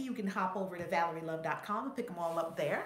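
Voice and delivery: usual speaking voice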